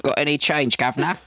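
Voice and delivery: high, playful fake Cockney beggar; Cockney accent. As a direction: put on a hammy Cockney accent. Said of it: "his fake Cockney accent".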